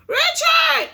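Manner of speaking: high, loud anguished call of the name; mother's distressed call from the yard. Acted. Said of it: "distress I sensed in her voice".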